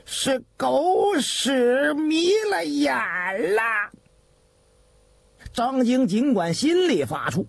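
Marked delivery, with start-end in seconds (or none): 0.0-3.6 慢而拖长，越骂越高; 老太监怒斥. Acted. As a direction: rebuke him slowly, voice climbing to the end. (怒声斥骂)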